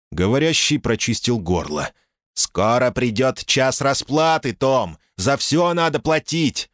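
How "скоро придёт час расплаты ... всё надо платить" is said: put-on high, slow, taunting American accent; mocking American accent. Caller threatens in Dickie voice.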